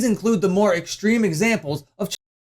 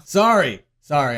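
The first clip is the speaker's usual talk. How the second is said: ordinary talk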